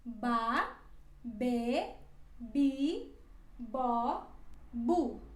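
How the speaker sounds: ba be bi bo bu, each syllable crisp and separate; syllable drill. Shown as a demonstration. Teacher drills the b syllables for learners. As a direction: model each syllable for repetition. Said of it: "ela fica assim".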